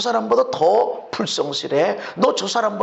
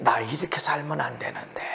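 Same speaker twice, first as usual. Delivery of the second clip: much softer, whispered self-reproach; 9 semitones lower, 10 dB quieter than usual. Retold or imitated. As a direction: breathe it like a private confession. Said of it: "그래야 우리 주변의 사람들이". (속삭이는 혼잣말)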